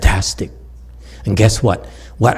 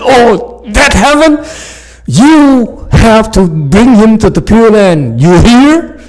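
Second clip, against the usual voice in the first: loud, high, slow warning from the master; stern master monk; 12 semitones higher, 8 dB louder, slower than usual. Retold or imitated. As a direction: drive the order home slowly and loudly. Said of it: "he said".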